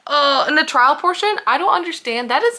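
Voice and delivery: worked-up outburst. Real, not acted. Worked-up rant.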